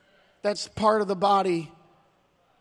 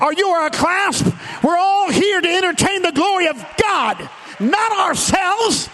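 rising, shouted sermon cadence; preaching; 6 semitones higher, 4 dB louder than usual. Acted. Pastor preaching loudly to the church about its body. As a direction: preach it hard to the congregation.

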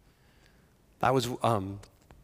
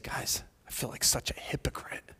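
whispered confession; 2 semitones higher, 6 dB quieter than usual. Retold or imitated. Friend confessing shame.

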